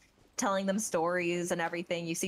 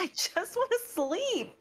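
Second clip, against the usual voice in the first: higher, slow, drowsy whine; Sayu's sleepy voice; 12 semitones higher, slower than usual. Retold or imitated. Sayu begging to sleep, high and slow. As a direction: drag it out like a sleepy kid. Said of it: "And Sayu's like".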